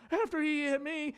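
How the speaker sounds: mock childish protest; protesting kid. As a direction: imitate a kid defending himself. His childhood self protesting to mom.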